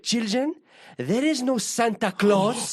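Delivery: put-on slow, high old Greek man voice; imitating an elderly Greek bishop, slow; Greek accent. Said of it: "this is in a Greek accent".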